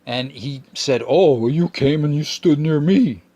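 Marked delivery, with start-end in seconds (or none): 1.1-3.2 slow, high, louder mock voice for the smoker. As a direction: drag out his comeback in a silly whine. Mocking the smoker's reply.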